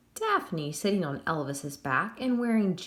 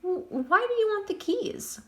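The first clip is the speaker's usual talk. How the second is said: much higher stammered question; stammering fear; 12 semitones higher than usual. A narrator's reading. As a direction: ask while shaking with fear. Crane scared questions ghost.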